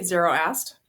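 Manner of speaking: ordinary delivery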